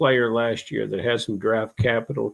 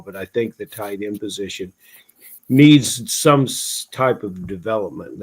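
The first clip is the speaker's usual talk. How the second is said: ordinary talk, nothing marked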